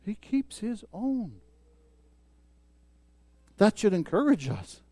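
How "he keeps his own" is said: much softer slow repeat of own; soft echo. Shown as a demonstration. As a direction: echo it quietly to let it sink in.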